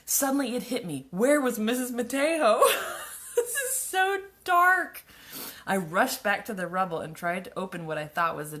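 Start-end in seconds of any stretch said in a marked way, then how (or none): 1.1-2.6 laughing. Real, not acted. Reading dark plot, laughing. 3.3-5.0 high, slow, laughing through the words; cracking up at how grim it is. Real, not acted. Laughing at her own story.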